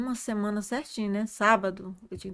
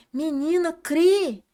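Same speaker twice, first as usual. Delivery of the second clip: higher retold exhortation; emphatic urging; 6 semitones higher, 5 dB louder, much slower than usual. Sister tells the doubting speaker to believe, emphatic urging. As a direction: urge her on with a raised voice.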